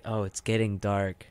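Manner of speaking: normal talking voice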